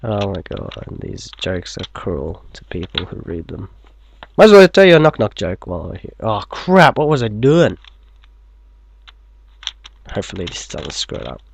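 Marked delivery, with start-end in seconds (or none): 4.4-6.1 fast. Acted. Fast excited aside, repeated.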